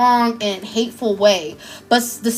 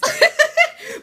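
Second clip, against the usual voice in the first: short laugh. Real, not acted. Laughing about childhood song.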